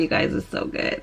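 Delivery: breathy whisper of delight. Real, not acted. Whispering to viewers how good the perfume smells. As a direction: hush it like a secret.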